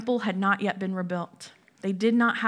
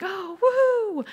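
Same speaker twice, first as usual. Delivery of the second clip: mock cheer; 9 semitones higher than usual. Mock cheer of weary world.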